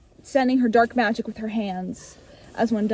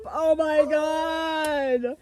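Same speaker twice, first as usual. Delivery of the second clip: slow, drawn-out higher oh my god; excited gasp; 4 semitones higher, much slower than usual. Real, not acted. Still thrilled by her own plot twist. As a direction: savor the surprise.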